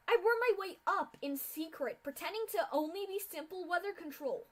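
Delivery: furious scream, high and loud. A narrator's reading. Mare screaming back at the filly who failed her. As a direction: spit the hate back at her. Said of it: "as she screamed in return".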